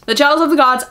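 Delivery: excited announce. Real, not acted. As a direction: announce the title with a flourish.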